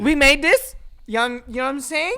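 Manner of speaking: high mock Canadian with upturned endings; Canadian rising lilt. Fan mimicking [name]'s accent, high Canadian upturns. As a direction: turn statements into questions, exaggerated Canadian. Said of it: "the voice just goes up".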